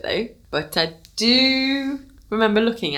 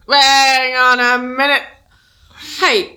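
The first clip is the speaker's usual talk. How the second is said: higher, louder acted objection; indignant protest; 6 semitones higher, 4 dB louder than usual. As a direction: cut in, stretching the words out high. Son protesting in acted family quarrel.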